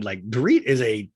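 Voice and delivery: normal talking voice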